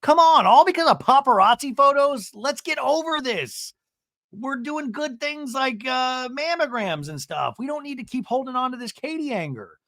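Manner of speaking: high, hammed-up caricature voice; Heather impression. Heather urging everyone past the Katie anger, high mock exhortation. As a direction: push it high, opening loud, imitating a pep talk. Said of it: "Heather goes".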